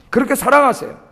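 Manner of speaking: ordinary talk, nothing marked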